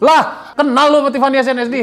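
high, loud incredulous question; loud disbelief. Real, not acted. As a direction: challenge them in comic disbelief. Incredulous challenge to co-host.

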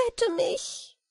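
fairy begging to be saved; pleading cry. Acted. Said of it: "Wieglinde rief".

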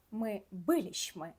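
"Ми билішми" slow, stress on "лі" of "билішми"; slow stressed Polish form. Shown as a demonstration. Teacher modelling the stress for students, slowly. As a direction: say "Ми билішми" slowly, stress third syllable from the end. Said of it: "наголос падає на третій склад з кінця".

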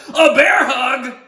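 put-on high, slow, excited kid shout. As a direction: shout it like a kid announcing a game. Boy calls out bear hug.

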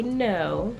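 ordinary talk

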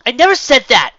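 high, loud indignant denial; shouted protest. A narrator's reading. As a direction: fire back defensively. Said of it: "Kim protested".

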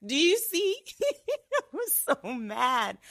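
high-pitched, giggly words; amused, talking through giggles; real laughing. Real, not acted. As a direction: laugh through it at your own expense. Laughing at her own anger.

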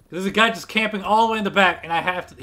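usual speaking voice

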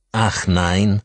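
sharp bitter rising Ach nein. A narrator's reading. Father answers visitor with sudden bitterness. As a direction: spit the question with bitterness. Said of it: "fragte er mit unerwarteter Bitterkeit".

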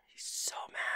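scared breathy whisper; soft frightened whisper. Real, not acted. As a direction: hush so the monster won't hear. Hiding from an angry Enderman.

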